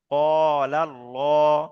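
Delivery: "কলাল্লাহ" drawn out very slowly with a thick L; spelling-out recitation. Shown as a demonstration. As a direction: recite "কলাল্লাহ", holding the qo and llo. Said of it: "বানান এবং উচ্চারণ করে নিলাম". ("কলাল্লাহ" টেনে, পুর ল দিয়ে)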